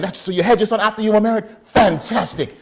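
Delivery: put-on loud, high, delighted Jamaican accent; overjoyed Jamaican elder, praising loudly; old-school Jamaican man. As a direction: exclaim with booming Jamaican approval. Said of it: "old, old school Jamaican man".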